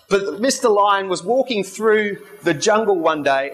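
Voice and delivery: ordinary talk, nothing marked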